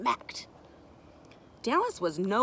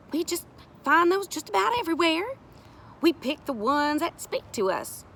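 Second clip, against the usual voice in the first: higher, lilting explanation; earnest woman character; 9 semitones higher, 4 dB louder than usual. Acted. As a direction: explain your craft to children. Sari explains the carving.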